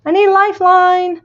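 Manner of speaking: high, loud playful plea; mock cry for help. Crafter out of strawberry stickers, playful cry for help. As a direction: call out for rescue in mock distress.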